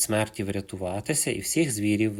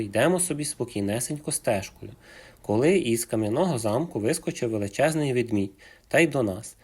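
usual speaking voice

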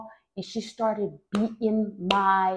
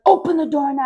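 higher, louder mock command; retold furious order, pitched up; demanding yell; 6 semitones higher, 6 dB louder than usual. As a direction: imitate a mother yelling through a door. Mother yelling at a locked door.

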